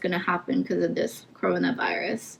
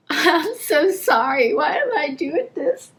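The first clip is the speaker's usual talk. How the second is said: high crying voice, embarrassed; pitched-up, tearful self-reproach; tearful apology; 5 semitones higher than usual. Real, not acted. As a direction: apologize while really crying. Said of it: "I don't know why I'm crying".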